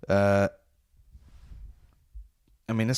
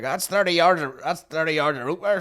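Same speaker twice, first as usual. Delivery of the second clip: higher-pitched mock Belfast seller; Belfast accent; 5 semitones higher than usual. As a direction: imitate a Belfast market trader. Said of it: "a bit of a Belfast accent".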